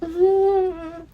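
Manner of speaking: a high, soft approving hum; lightly voiced blissed-out hum; mm-hmm. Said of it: "and I'm just like".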